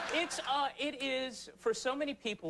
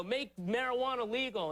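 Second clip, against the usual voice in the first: mock protester; 7 semitones higher than usual. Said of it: "you know".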